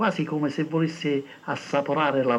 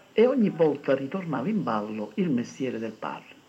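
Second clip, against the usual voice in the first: plain reading voice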